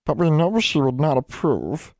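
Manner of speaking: high, squeaky elf voice, prim; disapproving house elf. Acted. House elf Nina warning Miss King would disapprove. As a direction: tut it like a prim little elf.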